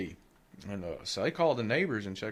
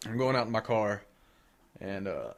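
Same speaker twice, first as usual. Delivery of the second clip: usual speaking voice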